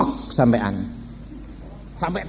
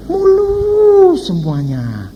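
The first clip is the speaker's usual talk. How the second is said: slow, high, drawn-out; slow, high teasing admiration; mock admiration; 13 semitones higher, much slower than usual. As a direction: stretch it out in mock wonder. Mocking perfect dating looks.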